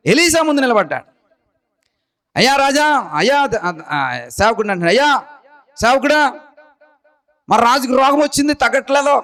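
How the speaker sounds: high, fervent preaching voice. Acted. Preacher telling the congregation of Hazael's plea, fervent. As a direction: lift the story up like a pulpit sermon.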